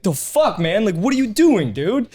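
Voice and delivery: retold exasperation, pitched high; indignant confrontation. Retelling the argument with the driver, indignant and high. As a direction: challenge him in mock outrage.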